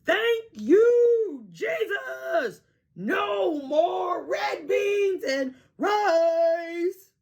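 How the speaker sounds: high, loud, drawn-out shout of joy; joyful shout. A narrator's reading. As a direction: shout your thanks to the sky. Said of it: "and shouted".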